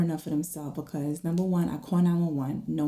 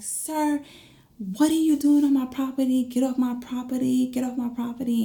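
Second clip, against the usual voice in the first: high, indignant scolding; raised pitch, indignant and insistent; retold mom's confrontation; 6 semitones higher than usual. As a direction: imitate a mother ordering a trespasser off. Mom confronts intruder, retold.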